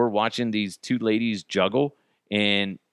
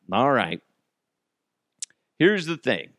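ordinary talk, nothing marked

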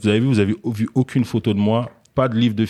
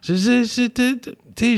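high flustered stammer; stammering principal. Retold or imitated. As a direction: fumble like a caught-out principal. Imite le directeur qui bafouille. (directeur qui bafouille, voix aiguë)

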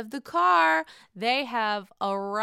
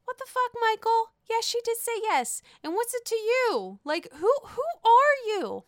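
indignant; 10 semitones higher than usual. Real, not acted. Ranting at Michael.

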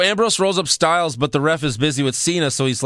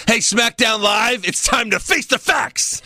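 mock wrestler promo; 8 semitones higher than usual. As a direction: announce it in a strained, high put-on voice.

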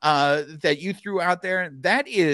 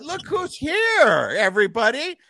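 excited announcer; 9 semitones higher, 4 dB louder than usual. Acted. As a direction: announce her like royalty arriving. Announcing the guest's arrival.

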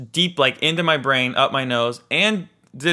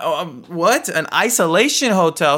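higher-pitched, taken-aback question; incredulous; 4 semitones higher, 4 dB louder than usual. Retold or imitated. Hotel guest hearing of the isolation hotel, incredulous. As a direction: recoil in disbelief at the news. Reacting to manager's bad news.

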